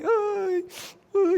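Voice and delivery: high, drawn-out "ay" of a hired mourner; put-on funeral lament; mock mourner's wail. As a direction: wail theatrically as if at a wake. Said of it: "como las plañideras que, que iban a los velorios".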